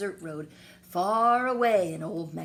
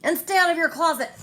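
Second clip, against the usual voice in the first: higher, louder and fast, a sharp final order; loud parting order; 11 semitones higher, 10 dB louder, faster than usual. A narrator's reading. Mother shouting a last order while closing the door. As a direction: fire it off as the door closes. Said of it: "she added".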